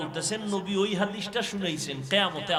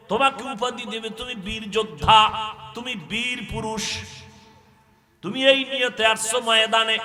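high, loud sermon delivery; accusing preaching; 5 semitones higher, 11 dB louder than usual. Acted. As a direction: preach God's accusation at full force. Preaches, voicing God's accusation.

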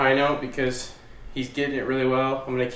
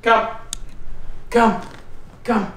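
calling the dog; 10 semitones higher, 4 dB louder, slower than usual. Real, not acted. Calling the puppy to come.

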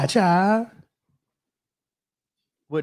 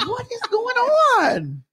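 high, slow, laughing bewilderment; baffled and laughing; 12 semitones higher, much slower than usual. Retold or imitated. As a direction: drag it out in disbelief. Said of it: "[name] was like".

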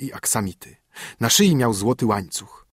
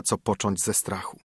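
ordinary delivery, nothing marked

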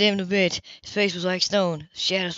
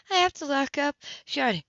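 Grace's voice; 7 semitones higher than usual. Acted. Grace voiced by male narrator.

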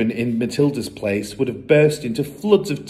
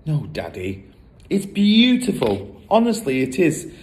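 put-on higher, earnest girlish voice; little girl pleading; 5 semitones higher than usual. Matilda defends her book to her father, high and earnest. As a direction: sweetly insist the book is lovely. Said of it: "Matilda pleads with father".

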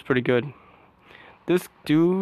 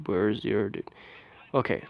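ordinary delivery

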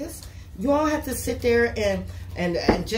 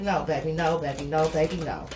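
mock firm refusal; 6 semitones lower than usual. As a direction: turn the partner down lightly. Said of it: "you like".

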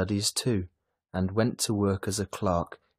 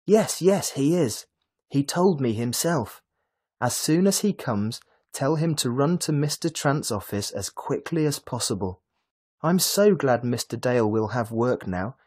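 higher, louder happy chatter; chattering excitedly; 6 semitones higher, 4 dB louder than usual. A narrator's reading. Lily reassuring Mrs Dale about the job, excited chatter. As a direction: bubble over with joy. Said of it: "Lily chattered excitedly".

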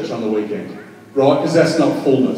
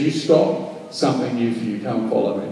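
quiet call; 4 semitones lower, 7 dB quieter than usual. Acted.

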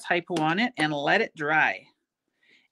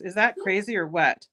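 normal talking voice

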